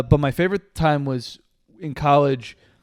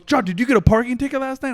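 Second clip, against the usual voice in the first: roommate's indignant demand; 9 semitones higher, 4 dB louder, faster than usual. Retold or imitated. Retells his roommate storming in.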